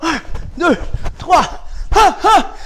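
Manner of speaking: high, loud karate count with kiai; loud, high-pitched instructor's call; shouted drill commands. Real, not acted. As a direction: bark out the count and punch it with sharp cries.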